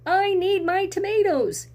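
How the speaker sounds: high, loud angry grocer; grocery man yelling. Acted. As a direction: bellow it down the sidewalk. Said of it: "stomped out on the sidewalk and yelled".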